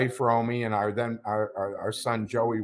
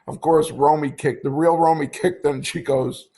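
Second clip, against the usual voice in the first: normal talking voice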